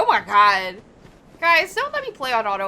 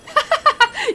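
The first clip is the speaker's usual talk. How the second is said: gremlin laugh; 14 semitones higher, 6 dB louder than usual. Real, not acted. Streamer laughing at chat.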